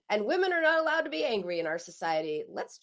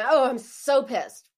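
louder, slow, demonstrated anger; felt anger; 3 semitones higher, 9 dB louder, slower than usual. Shown as a demonstration. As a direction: let the anger out as you say it. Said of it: "it's another thing to say".

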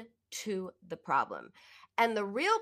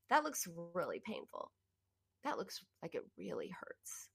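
tender validation; 2 semitones lower, 9 dB quieter than usual. Retold or imitated. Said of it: "I validated her and said".

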